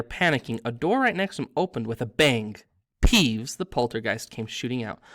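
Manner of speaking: ordinary delivery, nothing marked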